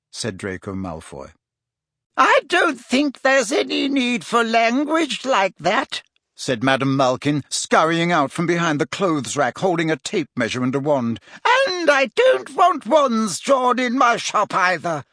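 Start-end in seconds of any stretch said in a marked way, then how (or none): 2.2-6.0 high, slow, disapproving shop owner; prim high-pitched reproof; Madam Malkin. A narrator's reading. Shop owner scolding a boy for his language, high voice. 11.4-15.0 high, raised voice of a flustered shop owner; Madam Malkin. A narrator's reading. Shop owner forbidding drawn wands, high and insistent. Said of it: "she added hastily".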